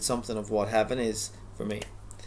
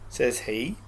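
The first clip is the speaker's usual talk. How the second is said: plain reading voice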